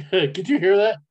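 ordinary talk

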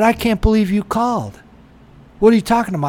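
indignant retelling, not fully shouted; retold going off. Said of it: "I was fucking going off on him".

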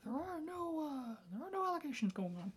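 high squeaky cartoon mouse voice, joking; Mickey Mouse voice. Joking about Disney allegations, a high Mickey squeak. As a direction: keep up the squeaky mouse bit. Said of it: "the Mickey voice".

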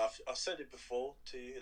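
usual speaking voice

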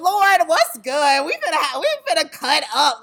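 excited. Retold or imitated. Dance teacher telling the Lord about her numbers, excited. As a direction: crow it to the Lord, laughing a little. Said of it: "Like, I'm excited".

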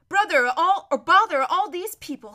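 annoyed outburst; exasperated. Acted. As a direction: burst out, fed up with the crowd. Character's exasperated thought.

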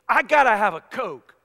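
mock-desperate, loud outburst; urgent craving. Husband waking up thirsty at night, mock urgent. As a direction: play up a sudden craving.

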